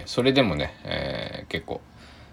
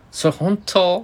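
surprised question; 13 semitones higher, 6 dB louder, slower than usual. Retold or imitated. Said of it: "みたいな".

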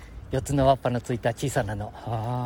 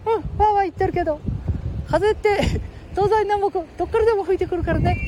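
put-on high squeaky boy voice; cheeky little kid, much higher; Ken-chan voice.

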